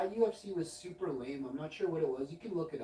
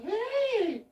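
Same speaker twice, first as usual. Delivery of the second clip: calling out; 7 dB louder than usual. Retold or imitated. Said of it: "She's like".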